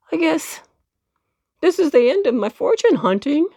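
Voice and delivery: hammed-up tearful goodbye; mock sad farewell. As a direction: say goodbye as if all is lost. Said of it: "two big tears rolled down".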